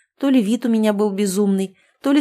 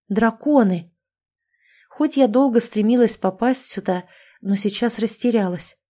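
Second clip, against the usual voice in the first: plain reading voice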